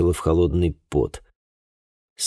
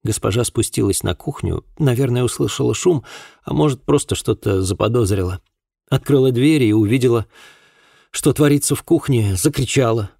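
put-on higher-pitched voice for a girl recounting events; distressed girl witness; 6 semitones higher than usual. A narrator's reading. Girl recounts that night to an investigator, tense. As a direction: tell it as a troubled young woman, pitched up.

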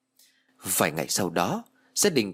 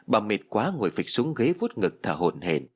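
usual speaking voice

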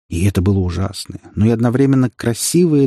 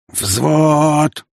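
high, sergeant's parade call; barked command; 6 semitones higher, 3 dB louder than usual. A narrator's reading. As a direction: bark it over the din. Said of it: "рявкнул он, перекрывая окружающий гвалт". (командирский рык)

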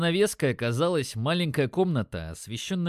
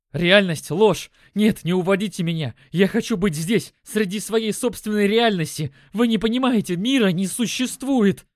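high, loud delirious raving; frantic protest; 7 semitones higher, 7 dB louder than usual. A narrator's reading. Delirious Ваня fighting off his rescuers, frantic shouting. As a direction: cry out desperately, resisting with every phrase.

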